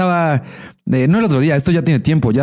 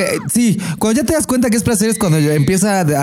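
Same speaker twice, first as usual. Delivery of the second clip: usual speaking voice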